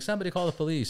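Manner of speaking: a loose shout of somebody call the police; vague bystander call. Retold or imitated. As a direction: call out to the crowd without picking anyone. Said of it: "if you're just like".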